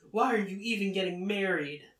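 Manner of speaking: acted yell, pitch jumping up on WHY; yelled question. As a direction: call it out indignantly after someone. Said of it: "Chitose yells".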